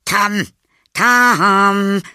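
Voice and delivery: put-on high, loud call for a missing boy. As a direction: call his name out, stretching the second one. Aunt calling out for the boy. (laut und hoch rufend)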